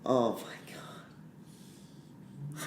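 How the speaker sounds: slow whispered despair; defeated whisper. Real, not acted. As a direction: exhale it hopelessly. Despair after the trade.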